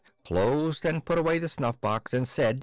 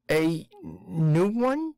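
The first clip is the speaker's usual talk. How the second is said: slow, stunned, far-away question; dazed as in a dream; 7 semitones higher, 3 dB louder, much slower than usual. Acted. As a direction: ask it like waking from a dream. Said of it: "as if still in a dream".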